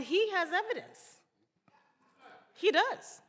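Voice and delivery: mock agreement, pitch jumping up on the last words; sarcastic jab. Real, not acted.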